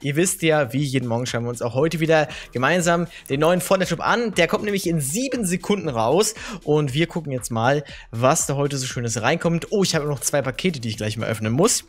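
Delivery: ordinary delivery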